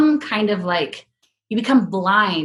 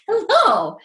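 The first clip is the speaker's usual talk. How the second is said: high, drawn-out hello; effusively welcoming; 9 semitones higher than usual. Retold or imitated. Imitating the drive-thru guy's hello, effusively welcoming. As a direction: sing out a grand greeting. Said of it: "like, welcoming".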